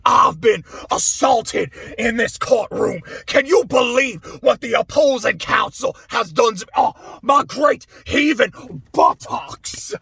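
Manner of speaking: hammed-up Southern courtroom indignation; over-the-top southern drawl. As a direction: play the wounded Southern lawyer, drawn out. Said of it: "over-the-top southern drawl".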